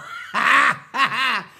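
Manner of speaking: loud guffaw at own joke; laughing. Real, not acted. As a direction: laugh out loud after the punchline.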